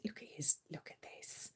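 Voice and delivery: hushed unvoiced whisper. Real, not acted. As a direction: whisper it aside. Whispering about cat.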